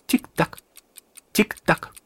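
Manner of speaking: two separated, crisp tick-tocks; ticking imitation. A narrator's reading. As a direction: tick like a clock, pausing between. Narrator imitates the ticking clock. (подражание тиканью часов)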